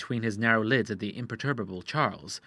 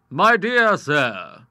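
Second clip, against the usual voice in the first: put-on polished old lawyer; 5 semitones higher, 8 dB louder, much slower than usual. Lawyer McAdam speaks.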